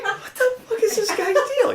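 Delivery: very high laughing question; laughing disbelief. Retold or imitated. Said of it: "I'm like".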